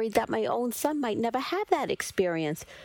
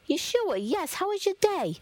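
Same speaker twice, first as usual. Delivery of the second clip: eager mother; 11 semitones higher than usual. Acted. Eager mother answering son's call.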